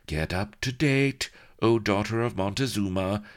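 high, matter-of-fact maid; practical handmaid. A narrator's reading. Handmaid setting the princess straight, lightly voiced. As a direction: set her straight.

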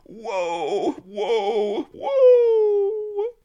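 very high, very loud crying wail; wailing rabbit. A narrator's reading. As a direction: howl in terror at the execution. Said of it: "wailed the Key Rabbit".

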